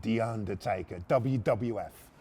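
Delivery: low, slow wrestling billing; announcer-style Undertaker voice. Acted. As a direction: intone it like a wrestler's grand title. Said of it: "I'll do it in that voice".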